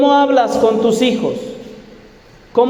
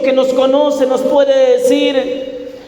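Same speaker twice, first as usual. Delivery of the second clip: normal talking voice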